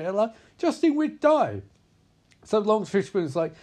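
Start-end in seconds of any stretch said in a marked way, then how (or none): 0.6-1.6 high, drawn-out mock protest; retold panicky plea, voice jumping up; mock alarmed warning. Crew begging Justin not to open up, mock alarmed.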